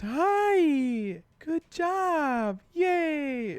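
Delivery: put-on very high, sing-song children's presenter; mock squeal of drawn-out, over-cheery praise; Miss Rachel. Mocking the kids' show on TV, high and over-cheery. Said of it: "something incredibly mundane like Miss Rachel".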